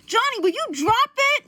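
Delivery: high, loud exasperated plea. Retold or imitated. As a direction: act out someone fed up with being nagged. Retold plea to drop it.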